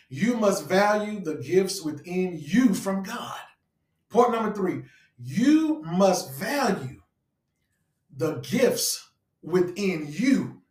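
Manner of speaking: measured, emphatic preacher; declarative preaching. Real, not acted. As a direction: announce it deliberately, stretching each key word. Announces the sermon point.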